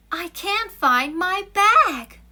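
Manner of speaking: high, loud, slow upset cry; distressed exclamation. Shown as a demonstration. Teacher showing an exclamatory sentence, upset and loud. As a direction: sound frantic about the lost bag. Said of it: "exclamation mark 있네".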